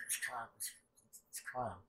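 ordinary delivery

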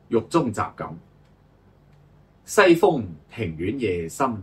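slow, measured verse reading with pauses between lines; classical poem recitation. Acted. Sharing selected prison poems with listeners, solemn poem recitation. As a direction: recite line by line, each opening strongly and falling away.

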